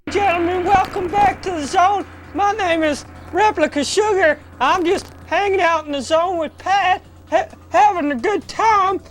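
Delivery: put-on Southern Belle accent. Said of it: "get a Southern Belle accent".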